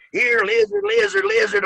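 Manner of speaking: mock lizard call. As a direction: call it out over the microphone like a chant. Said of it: "he's going".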